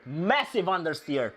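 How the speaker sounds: usual speaking voice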